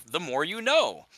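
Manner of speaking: slogan quote. Retold or imitated. Podcaster quotes the cartoon's moral tagline, slogan quote. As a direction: punch it up like a PSA tagline.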